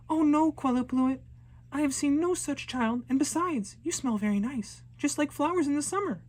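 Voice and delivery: put-on high, eager little girl sweet-talking; nervous flattering girl. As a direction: charm the monsters to escape.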